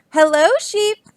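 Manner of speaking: high, bright greeting from Duck. Acted.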